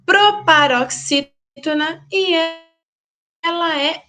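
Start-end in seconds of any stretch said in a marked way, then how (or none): none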